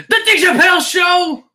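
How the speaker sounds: high loud call with a laugh; laughing shout-out. Real, not acted. Streamer laughing as he shouts out a commenter. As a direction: cheer the name out laughing.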